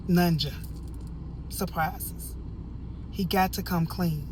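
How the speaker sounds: usual speaking voice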